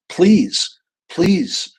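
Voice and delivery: acted begging, high and loud; plead, each word rising hard then falling; desperate plea. Client begging the therapist to go ahead, pleading.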